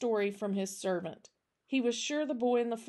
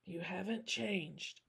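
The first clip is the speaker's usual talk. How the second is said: put-on slow, hushed old-man murmur; very soft, drawn-out aside; whispered; 9 dB quieter, slower than usual. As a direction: whisper it slowly to an old foe. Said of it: "Jack whispered".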